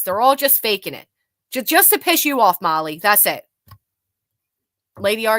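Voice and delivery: ordinary talk, nothing marked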